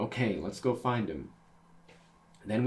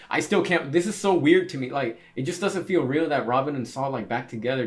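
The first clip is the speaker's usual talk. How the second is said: excited; 5 semitones higher, 8 dB louder than usual. Real, not acted. Reader reacting to reunion.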